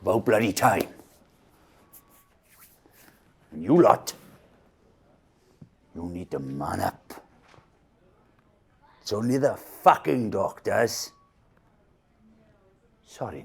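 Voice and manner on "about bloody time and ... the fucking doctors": louder, blustering; gruff loud scolding. Acted. Victor scolding fellow patients as he leaves, loud. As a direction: tell them off on the way out.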